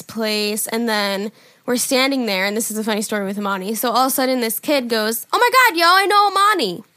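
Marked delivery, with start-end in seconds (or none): 5.3-6.8 high, loud excited exclamation; excited kid. Retold or imitated. Kid spots someone who knows Amani, high excited cry. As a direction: blurt it out, high and thrilled.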